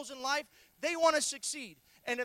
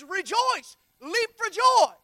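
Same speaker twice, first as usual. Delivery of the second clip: higher, loud scripture call; exultant quote; 4 semitones higher, 8 dB louder, much slower than usual. Acted. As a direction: lift the verse up joyfully.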